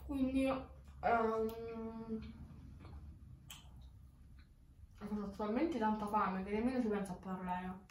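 speaking with food in mouth; words muffled by chewing; talking while chewing. Real, not acted. Seen 3: bites pizza just before; holds slice, mouth moving, likely still chewing.

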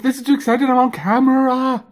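high, excited squeaky ferret voice. Pretend. Owner voicing her ferret, thrilled to be on camera. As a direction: gush like a starstruck pet. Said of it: "She said".

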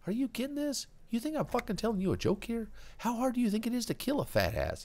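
put-on very high, insistent kid voice; Kuno's intense kid voice. Kuno pressing the cop about the killer, high. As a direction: push it hard at the cop.

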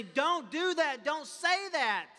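slow loud warning voice; conscience warning. Retold or imitated. Pastor voicing the conscience, slow and loud. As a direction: say it as the conscience stopping you.

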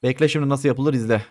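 usual speaking voice

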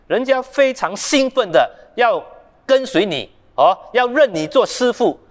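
normal talking voice

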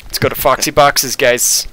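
normal talking voice

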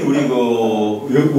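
ordinary talk, nothing marked

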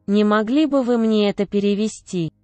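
normal talking voice

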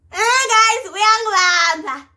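high, slow, drawn-out wail; loud protest. Retold or imitated. Patient as he tries to position her, loud protesting wail.

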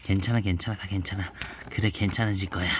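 soft, gentle bedtime story reading; soft picture-book reading voice; reading to a child. Acted. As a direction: read it softly to your little one. Mother reads picture book aloud.

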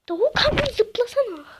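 loud, high urging shout; yelling at character. Real, not acted. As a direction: yell at the screen to jump. Yelling at game character to jump.

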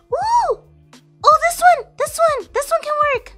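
high, loud delighted squeals; excited. Real, not acted. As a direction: burst out with glee. Excited over cooking find.

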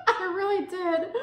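laughing through the words, high; giggling it out; laughing as she admits it. Real, not acted. Laughing recalling the birth.

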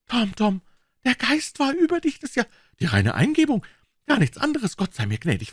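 put-on high, amazed old aunt; old aunt overcome with pious wonder; Aunt Polly. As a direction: marvel at a miracle, voice soaring.

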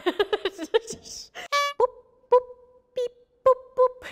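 high mock beep boop noises; sound effects. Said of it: "actual sound effects".

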